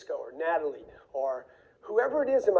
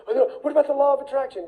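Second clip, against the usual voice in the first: fast, higher mock-whiny voice; mocking whiny questioner; 7 semitones higher, 4 dB louder, faster than usual. Mocking everybody who asks about the law of attraction, whiny and fast. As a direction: parody the people who nag him.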